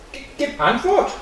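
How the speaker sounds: high, stammered demand; boy calling out. A narrator's reading. Boy orders the silent figure to answer, calling out high. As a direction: demand an answer, voice pitched well up. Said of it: "rief der Junge".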